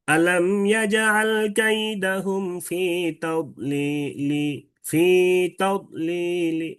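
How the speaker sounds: very slow chant, long vowels, "তাদলিলি" ending on a light short "li"; drawn-out recitation with a faint last vowel in "তাদলিলি", no n; rawm recitation. Shown as a demonstration. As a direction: stretch the notes, then barely sound the final i of "তাদলিলি". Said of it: "লাস্টে একটা হালকা".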